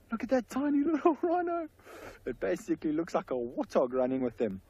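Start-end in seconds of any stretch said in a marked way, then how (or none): 0.1-1.7 high delighted gush over a tiny calf; thrilled and laughing, pitched way up; excited. Real, not acted. Delighted guide sees tiny calf.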